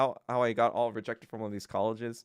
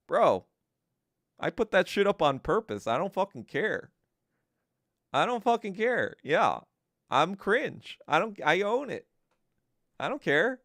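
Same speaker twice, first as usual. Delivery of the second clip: loud, defiant comeback to trolls; defiant retort; 5 semitones higher, 5 dB louder than usual. Retold or imitated. To party trolls, a loud defiant comeback. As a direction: fire it back, proud and loud.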